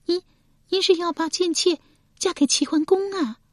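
surprised question. A narrator's reading. Female character voice.

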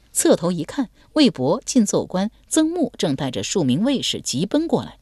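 plain reading voice

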